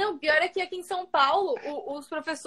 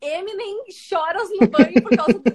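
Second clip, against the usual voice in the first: normal talking voice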